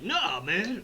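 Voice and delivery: lightly voiced hippie dude. Super dude putting off the fight, lightly voiced hippie. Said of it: "hippie sounding".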